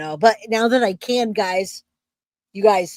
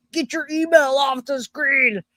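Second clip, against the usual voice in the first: mock yell; 5 semitones higher than usual. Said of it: "she's yelling at me".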